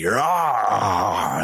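playful growl like a bear. Pretend. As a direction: throw out a short bear roar. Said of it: "That's my bear growl".

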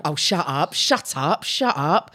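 low Essex "shut up"; Essex catchphrase. Retold or imitated. Quoting her own habit, low Essex accent. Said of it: "we talk like that".

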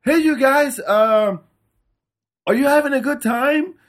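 mock the singer's corny crowd greeting; cheesy band banter. Mocking the band's singer greeting the crowd, high and cheesy. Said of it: "And it's like".

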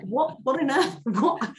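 high, loud disbelief with a laugh; incredulous surprise. Retold or imitated. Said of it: "And they were like".

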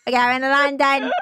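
mock British accent. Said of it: "Whenever we do a British accent".

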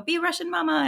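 high-pitched imitation of a child; child's request. Son asking mom to play Russian mama, high childlike plea. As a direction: pipe up high like a kid. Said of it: "he'll go".

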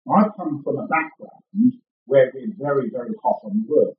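ordinary talk, nothing marked

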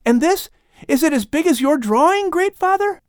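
put-on high, eager child's question; a boy pointing at a drawing; young Lamech asking Adam about a drawing, high and curious. A narrator's reading.

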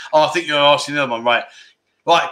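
normal talking voice

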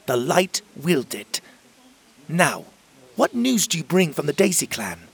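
high, loud and resolute; firm fairy queen Emerald. Acted. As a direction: settle the matter with authority. Emerald defends Will firmly.

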